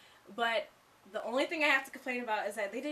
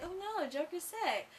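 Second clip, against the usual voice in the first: mock dismay; 8 semitones higher than usual. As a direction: coo it in exaggerated concern. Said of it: "I was like".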